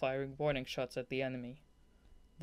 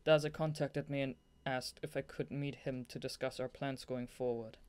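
ordinary delivery

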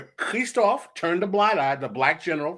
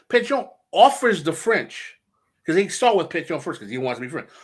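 ordinary talk, nothing marked

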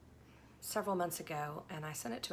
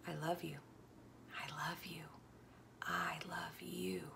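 hushed loving whisper; tender whisper; 3 semitones lower, 9 dB quieter than usual. Acted. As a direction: breathe the words like a secret. Whispered divine love.

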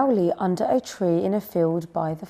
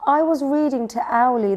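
high, louder little owl complaint; indignant explanation; 6 semitones higher, 5 dB louder than usual. A narrator's reading. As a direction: explain the grievance to dad. Upset owl explains to dad.

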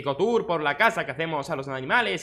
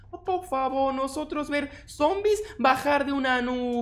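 higher broken-Spanish villager, slow; 8 semitones higher, slower than usual. Acted. Villager pleading with the player about zombies in higher broken Spanish. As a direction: play the simple village folk.

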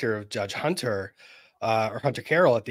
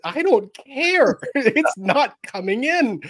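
high, slow, laughing through the words; much higher pitch, drawn out and giggling; laughing refusal; 12 semitones higher, 5 dB louder, slower than usual. Retold or imitated. Retelling his answer to Nick, high slow laughing refusal. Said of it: "I said".

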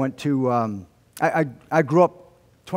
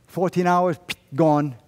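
ordinary talk

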